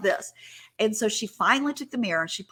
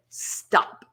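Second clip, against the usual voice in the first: imitating a firm, commanding stop; authoritative. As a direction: cut them off with authority. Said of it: "she was very authoritative when she said it".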